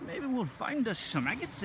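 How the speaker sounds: put-on light old-timer prospector twang. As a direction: keep a hint of the old miner. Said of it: "It's the ye old prospector".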